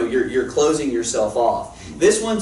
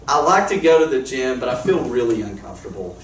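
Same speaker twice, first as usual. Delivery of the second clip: usual speaking voice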